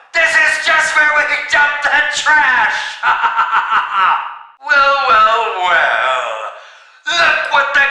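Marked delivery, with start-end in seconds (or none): 2.8-4.4 short evil chuckle; gloating villain chuckle; villain laugh. Acted. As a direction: chuckle at the insult.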